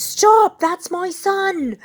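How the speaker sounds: high, loud, slow pleading yell; desperate shout. A narrator's reading. Mr Weasley shielding his son from the wizards, loud desperate yell. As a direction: cry out to save his son.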